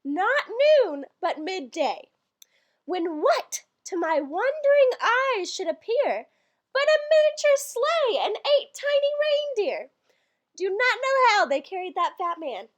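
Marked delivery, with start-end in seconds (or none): 2.8-9.9 rising to a higher, awed verse line; wondering poem recital. Acted. Narrating the poem's reindeer reveal, awed recital. Reads Christmas poem aloud.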